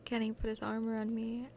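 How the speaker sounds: usual speaking voice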